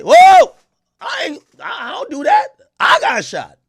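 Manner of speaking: alarmed protest, very high and loud; hammed-up high-pitched denial, voice leaping. Pop star denying the gangster rumors, mock-indignant. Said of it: "[name]'s gonna come out and say".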